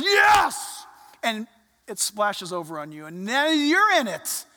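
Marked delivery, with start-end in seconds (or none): 0.0-0.9 high, loud cry of yes; a shouted yes of joy; joyful shout. Retold or imitated. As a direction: burst out in delight. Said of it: "and he goes".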